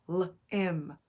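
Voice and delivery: "L-im" broken into L, then im; tapped-out sounds. Shown as a demonstration. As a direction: tap out "L-im", onset apart from the rest. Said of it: "you can still tap the sound".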